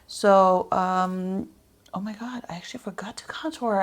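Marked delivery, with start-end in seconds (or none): 1.9-2.4 startled whisper; hushed dismay at a mistake. Real, not acted. Realizing she forgot a step. 2.5-3.8 fast whispered confession; whispered dismay, quick. Real, not acted. Whispered realization of forgotten step.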